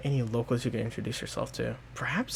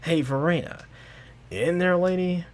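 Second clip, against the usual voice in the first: louder, higher call into the room; 7 semitones higher, 7 dB louder than usual. Acted. Zinnia calling Verena awake, loud and high. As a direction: call out to wake her.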